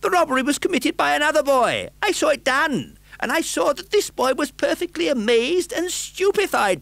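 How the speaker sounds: very high, loud witness account; urgent testimony. Acted. Bookseller telling what he saw, high and urgent.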